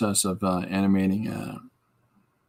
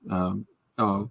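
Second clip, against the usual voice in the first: ordinary talk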